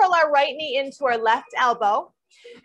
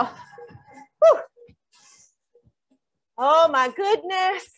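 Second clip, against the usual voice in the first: excited whoop; 2 semitones higher, much slower than usual. Real, not acted. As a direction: cheer like the party started. Cheer as burst starts.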